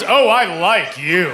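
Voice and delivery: won over despite herself; mock charmed lady. Hostess charmed by son.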